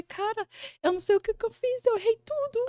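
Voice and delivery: lamento agudo e mais baixo, encenado; choro imitado. Retold or imitated. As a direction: choraminga fininho, imitando o próprio desespero. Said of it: "e chorando". (voz chorosa e aguda de imitação)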